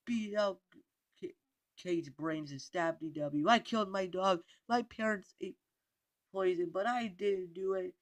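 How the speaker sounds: high, very loud frantic confession; screamed last words. A narrator's reading. Arthur in prison shouting his last words, screaming. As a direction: scream the confession out, blaming someone else. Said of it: "His last words were louder".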